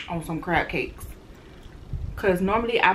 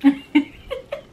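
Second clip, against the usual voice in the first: giggle; 7 semitones higher, 3 dB louder than usual. Real, not acted. Laughs before last bite.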